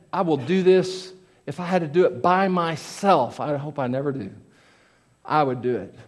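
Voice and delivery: usual speaking voice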